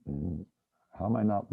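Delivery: usual speaking voice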